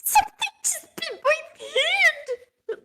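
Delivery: very high, drawn-out whimper; hammed-up tearful squeal, close to laughing; pained squeal. Bitten character answers in pain.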